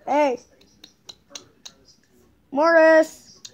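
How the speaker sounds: loud call to a dog. Real, not acted. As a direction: call across the room to the pug. Calling the dog Morris.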